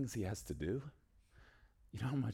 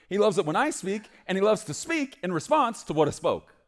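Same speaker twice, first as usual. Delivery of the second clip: much higher and louder, full preaching cry; fervent preaching; 13 semitones higher, 11 dB louder than usual. Acted. Preacher crying out to the congregation, full force. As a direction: build it into a shout of joy.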